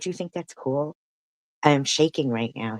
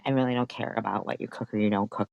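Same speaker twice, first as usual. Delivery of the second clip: ordinary delivery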